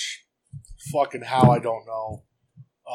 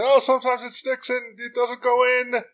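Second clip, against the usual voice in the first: higher, slow, put-on fretting voice; lightly hammed-up excuse, higher and slower; mock flustered woman; 10 semitones higher, slower than usual. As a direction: play her excusing the stuck Jeep in a high, drawn-out voice. She runs out explaining the Jeep.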